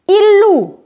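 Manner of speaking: "Il loue" said as "lou", the final E not sounded; teacher gives "Il loue" a clear OU ending and no E; silent E. Shown as a demonstration. Teacher shows students "Il loue" with the E silent. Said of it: "Je ne prononce pas le E".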